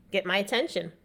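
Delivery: ordinary talk, nothing marked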